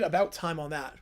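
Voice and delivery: plain reading voice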